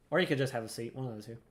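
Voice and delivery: ordinary talk, nothing marked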